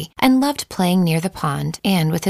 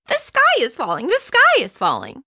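much higher, panicked chick calls; a little chick raising the alarm down the road; frantic shouting chick; 10 semitones higher than usual. Acted. Chicken Little running into town, high panicked calls. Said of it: "he shouted".

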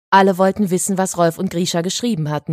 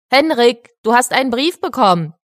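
put-on higher, louder call to a boy; 6 semitones higher, 5 dB louder than usual. A narrator's reading. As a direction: call out the news to a child. Director calls boy to give letter.

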